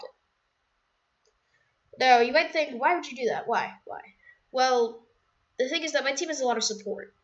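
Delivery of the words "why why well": ordinary talk, nothing marked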